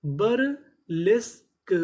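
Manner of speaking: बरलेस्क stretched slowly, syllable by syllable, rising at the end; teacher sounding out बरलेस्क slowly; slow drawn-out demo. Shown as a demonstration. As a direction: draw out बरलेस्क for the class. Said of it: "तो हमारी पूरी meaning क्या बनी?".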